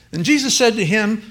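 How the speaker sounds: ordinary delivery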